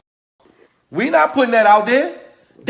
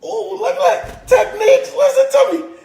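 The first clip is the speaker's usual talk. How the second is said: high and bright-eyed, much higher pitch, thrilled exclamations; excited; 13 semitones higher, 4 dB louder than usual. Real, not acted. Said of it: "I'm super duper excited".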